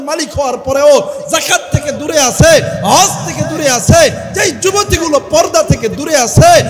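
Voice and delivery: loud, high impassioned sermon cry; preaching at full force. Acted. Preacher pleading loudly with the sinning youth. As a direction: thunder it out to the crowd. Climactic rebuke list.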